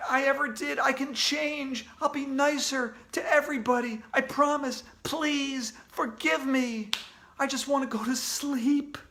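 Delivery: lightly voiced whiny kid begging; pleading. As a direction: beg desperately for a way out. Boy begging to avoid wedding.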